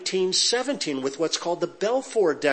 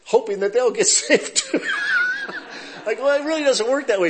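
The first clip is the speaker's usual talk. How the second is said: amused, higher and louder, laughing; 8 semitones higher, 4 dB louder than usual. Real, not acted. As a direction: laugh through the punchline. Laughs at own joke.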